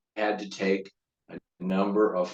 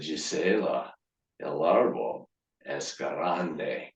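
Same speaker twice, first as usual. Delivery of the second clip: low, slow, soft would-be seduction; slow sexy voice; 8 semitones lower, 5 dB quieter, slower than usual. Acted. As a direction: croon it slowly, as sexily as you can.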